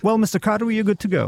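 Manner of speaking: border officer. Retold or imitated. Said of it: "så sætter han sådan en stamp".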